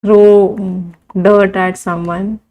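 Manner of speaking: very slow, word by word dictation. Shown as a demonstration. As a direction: spell it out slowly for the class to write. Teacher dictates idioms slowly. (धीरे धीरे लिखवाते हुए)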